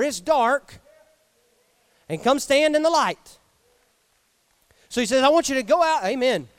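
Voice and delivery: loud, fervent preaching. Acted. Preacher calling the congregation out of the dark cave, loud and fervent. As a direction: preach it full force to the congregation.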